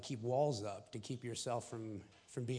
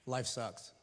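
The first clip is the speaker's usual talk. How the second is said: ordinary talk, nothing marked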